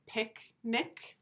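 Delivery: picnic slowly broken as "pick", pause, "nick"; syllable division. Shown as a demonstration. Teacher having pupils say it, split by syllable. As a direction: leave a clear gap inside picnic. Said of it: "The syllable division is right here".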